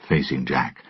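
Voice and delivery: plain reading voice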